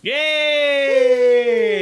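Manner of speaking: loud high cheer; excited cheer. Real, not acted. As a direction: whoop it up with joy. Cheering the movie topic.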